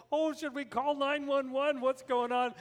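high, loud panicked questions; mock panic. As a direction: act out alarm at an emergency. Retold panic over bleeding neighbor.

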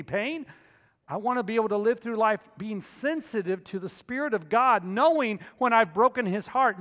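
loud, high, impassioned sermon delivery; fervent preacher at full volume; full-force preaching. Real, not acted. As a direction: drive the conviction home to the congregation.

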